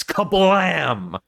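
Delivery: big, high mock crash effect; imitated huge slam. Film host imitating the title slamming into frame, mock crash. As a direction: boom it out like a cartoon sound effect. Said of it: "hit with a huge sound effect".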